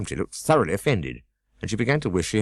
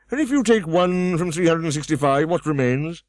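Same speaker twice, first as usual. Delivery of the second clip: higher, louder pompous scold; Humpty Dumpty scolding; 10 semitones higher, 3 dB louder than usual. A narrator's reading. Humpty Dumpty scolding Alice, higher and louder. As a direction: snap at the girl from the wall.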